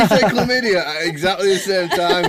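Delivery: laughing through the words; giggling while saying it. Real, not acted. Friend laughing at a shared jinx.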